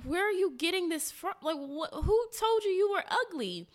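high-pitched, incredulous mock questioning; shocked mom. Mom asks her daughter who called her ugly, high-pitched retold shock. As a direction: retell a mother's disbelief, pitched well up.

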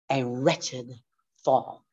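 ordinary talk, nothing marked

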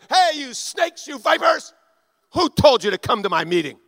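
loud, high prophet's tirade; imitated angry yelling. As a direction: berate the crowd at full volume, acting outraged. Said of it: "he yells at them during the service".

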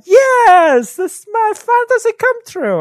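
high, delighted squeal of joy; gushing fantasy voice. Pretend. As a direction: squeal it like a dream come true. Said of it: "and you're like".

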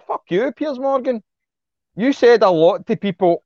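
high, scornful outburst; swearing at a TV presenter; angry curse. Real, not acted. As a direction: spit it at him. Cursing at Piers Morgan.

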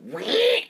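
high vocal squeal imitating a record scratch; record scratch noise. Party going silent, mock record-scratch squeal. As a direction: screech like a record stopping dead. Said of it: "you hear the fucking record go".

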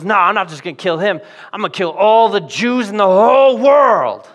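hammed-up villain fury, voice climbing on world; Haman's rage. Haman to his buddies, vowing genocide, raging. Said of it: "they actually underestimated Haman's rage".

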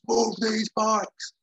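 high, drawn-out mock scream of command; soldier's scream. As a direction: yell it slowly like an irate soldier. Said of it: "the soldier screams".

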